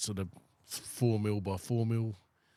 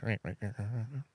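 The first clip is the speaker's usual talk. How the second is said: imitated cat meowing; 4 dB quieter than usual. Man shows how his cat comes in, mock meowing. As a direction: run of throaty cat meows. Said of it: "he comes in and he goes".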